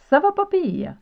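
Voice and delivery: cheerful acted reply. Shown as a demonstration. Teacher plays a friend answering a greeting, high and lively.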